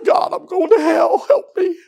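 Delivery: high, pleading call for help; desperate cry to God. Retold or imitated. Boy at the altar pleads with God, desperate cry. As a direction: cry out like a lost child.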